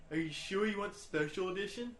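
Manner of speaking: put-on dopey troll voice; a dopey troll asking the question.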